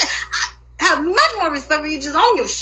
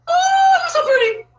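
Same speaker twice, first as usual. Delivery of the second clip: much higher, sugary fake compliment; mock gushing. Mocking the women who praised her looks, high gushing. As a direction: gush like a fake admirer.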